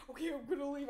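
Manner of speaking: mock panicked announcement; retold freak-out. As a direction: imitate him freaking out. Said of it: "So he starts freaking out".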